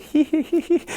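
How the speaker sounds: high-pitched teasing hee hee; a squeaky mocking laugh; mocking giggle. Old man laughing at the student, mocking giggle. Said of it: "I was like".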